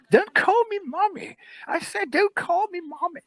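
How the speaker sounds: put-on high, squealing old-lady voice; indignant old woman. Homeless woman objecting to the guard, high mock indignation.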